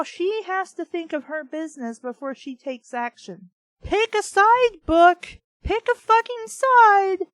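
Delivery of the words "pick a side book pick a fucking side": high, loud, slow yelling; furious shout. Real, not acted. Reader yelling at the inconsistent novel, furious shout. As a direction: scream at the book to decide.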